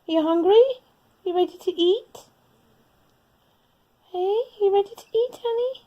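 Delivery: calling a cat to eat in a sing-song voice; high pet-talk. Real, not acted.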